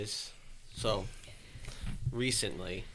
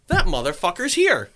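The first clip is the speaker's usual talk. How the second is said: higher, louder mock exclamation; excited realization; 7 semitones higher, 7 dB louder than usual. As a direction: act out catching the intruder. Spots the shadow in the house.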